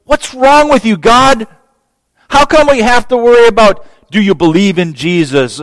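high, loud, exasperated cry at God; frustrated complaint. Retold or imitated. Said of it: "We're like".

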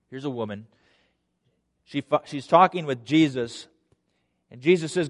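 ordinary talk

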